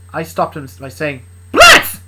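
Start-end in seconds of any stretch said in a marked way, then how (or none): 1.5-2.0 very high, loud yell of his name; sharp shout. Acted. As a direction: cut him off with a yell. Shouting to stop him.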